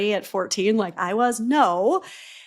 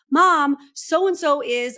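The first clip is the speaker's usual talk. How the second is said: put-on high, slow tattling kid; whiny child; 6 semitones higher, 6 dB louder, much slower than usual. Child complaining to mom.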